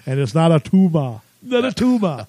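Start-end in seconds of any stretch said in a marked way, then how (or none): 1.4-2.3 put-on higher Austrian-accented movie-star voice; a quick Austrian accent send-up; Arnold Schwarzenegger impression. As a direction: repeat it like Arnold in Kindergarten Cop. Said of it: "the greatest Arnold quotes".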